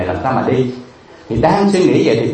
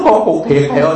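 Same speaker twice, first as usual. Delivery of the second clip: raised, higher mock wail of a child; imitated crying wail; 4 semitones higher, 3 dB louder than usual. Begging child insists to monk it is real, imitated wail. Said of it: "Nó khóc nó gào lên".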